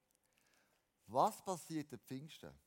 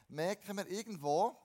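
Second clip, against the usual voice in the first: usual speaking voice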